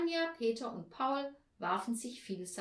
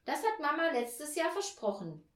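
put-on louder, insistent boy's voice; child shouting; 6 semitones higher, 5 dB louder than usual. A narrator's reading. Paul claims his turn in the quarrel, mildly shouted. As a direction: press the claim in a raised child voice. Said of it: "schrie Paul".

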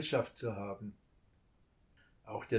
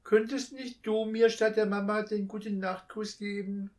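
put-on high, louder small child's plea; pleading child; 9 semitones higher, 6 dB louder than usual. A narrator's reading. Child asks the thief for a goodnight kiss. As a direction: ask shyly like a little child. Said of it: "rief es dem Dieb nach".

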